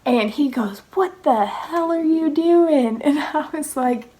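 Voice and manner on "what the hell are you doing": higher, drawn-out mock outrage; retold sergeant scolding. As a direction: imitate an incredulous sergeant, slowly. Retold drill sergeant confronting her.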